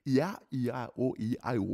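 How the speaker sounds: open, rhythmic vowel sounds; vocal warm-up drill. Shown as a demonstration. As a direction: work the mouth through each vowel. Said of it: "we do the alphabet".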